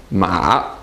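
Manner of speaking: MA dipping low, then back up; low MA that falls and rises; third tone. Shown as a demonstration. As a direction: scoop MA down and up. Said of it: "Third tone".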